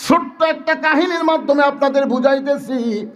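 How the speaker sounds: slow, high, drawn-out sermon chant; high melodic preaching. Real, not acted. Preacher tells mothers the worth of veiled women, melodic preaching. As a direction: hold the phrases long and high.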